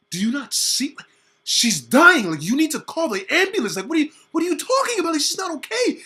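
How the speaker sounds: high, loud disbelieving shout; high loud panicked outburst; frantic yell. Retold or imitated. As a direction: yell it at mom in alarm. Kid yelling at mom.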